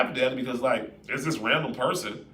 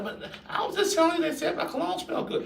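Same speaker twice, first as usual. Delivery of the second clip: protesting husband. Pretend.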